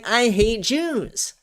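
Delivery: sarcastic parody of the claim; slow mocking sneer. Host mocking Ted Cruz's claim, slow sneering parody. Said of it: "It's like saying".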